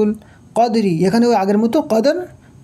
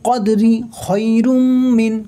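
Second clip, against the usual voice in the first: Quran recitation; much slower than usual. Shown as a demonstration. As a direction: draw every vowel out in melodic tajweed. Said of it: "মিলিয়ে পড়বো".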